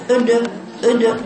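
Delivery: mock the faulty bounce on أد; wrong recitation. Shown as a demonstration. Teacher mimics a wrong qalqala for students. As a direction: say أد، أد slowly, the د pushed out with a vowel. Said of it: "مفيش كده أبدًا".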